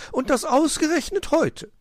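very high bird voice; high-pitched, aggrieved magpie; indignant magpie. A narrator's reading. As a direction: announce it in a high squawk. Magpie reports fox missing.

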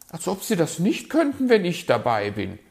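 high, loud, huffy retort; insulted bird lady; offended magpie. A narrator's reading. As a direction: pipe up, quick and affronted. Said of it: "war die Elster beleidigt".

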